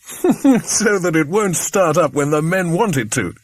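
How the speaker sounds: laughing through the words. A narrator's reading. Bill explaining his sabotage plan, laughing. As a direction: chuckle at the trick as you say it. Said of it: "said Bill with a laugh".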